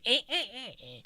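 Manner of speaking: high squeaky cackling giggle; squeaky alien laugh. Retold or imitated. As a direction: cackle like a tiny cartoon alien. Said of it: "laughing in a squeaky alien voice".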